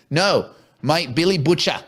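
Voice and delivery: emphatic mock Cockney, "Butcher" as "Butch-ah"; Cockney accent. Shown as a demonstration. Host coaching co-host on the name, Cockney accent. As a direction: correct him in a Cockney accent. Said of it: "You gotta talk like Billy Butcher".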